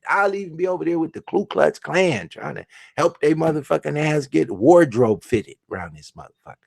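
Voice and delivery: usual speaking voice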